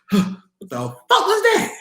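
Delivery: a startled high voice just after waking; high bewildered cry. Retold or imitated. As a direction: ask it in wide-eyed confusion. Waking up confused after the projection.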